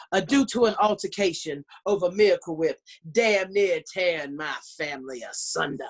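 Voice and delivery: put-on theatrical Southern belle accent; Savannah Southern. Southerner explaining a mayonnaise feud to Portlanders, theatrical Savannah Southern. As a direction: ham up the Southern accent like an entertainer. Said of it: "Savannah Southern".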